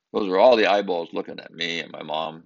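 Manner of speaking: ordinary talk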